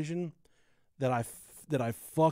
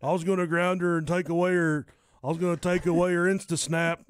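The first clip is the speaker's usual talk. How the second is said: put-on southern accent; 4 semitones higher, 5 dB louder than usual. Break room guy rants in a mock Southern accent. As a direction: ham up an angry Southern guy at work, louder. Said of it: "you use a southern accent".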